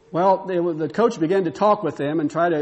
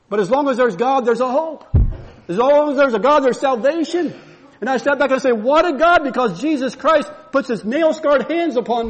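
higher, louder fervent preaching; full-force preaching; 6 semitones higher, 4 dB louder than usual. Acted. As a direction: preach it out to the congregation.

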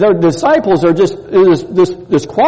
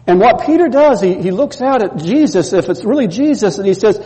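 usual speaking voice

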